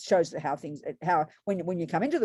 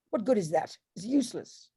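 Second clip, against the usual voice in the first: normal talking voice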